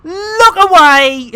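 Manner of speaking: loud, slow warning cry; mock alarm. Real, not acted. Streamer yelling at chat to look away, slow loud cry. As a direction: yell at the viewers to look away. Covering an embarrassing sight.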